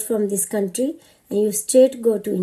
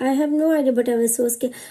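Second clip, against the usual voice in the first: ordinary talk, nothing marked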